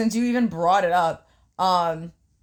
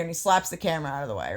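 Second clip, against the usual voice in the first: usual speaking voice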